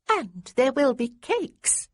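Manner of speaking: put-on high, slow and prim little girl; a proper little lady, slow and prim. A narrator's reading. Little girl tempting Marcus with cakes, prim and slow. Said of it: "in a prim little voice".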